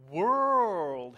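"World" stretched out long and loud; stress on World. Shown as a demonstration. Preacher correcting National to World, drawn-out stress on "World". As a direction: lean hard and slow on "World".

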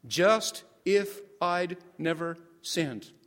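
JUST, IF, I'D as separate stressed words, then "never sinned"; slow, syllable by syllable. Shown as a demonstration. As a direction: say "Just if I'd never sinned" very slowly, a pause after each beat. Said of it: "very slowly and emphasize each syllable".

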